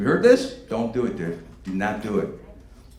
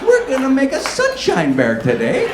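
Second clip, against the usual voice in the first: put-on much higher, louder baby-talk; mock sing-song woman; 13 semitones higher, 8 dB louder than usual. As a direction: coo it like a mom to a toddler. Women talking to man as toy.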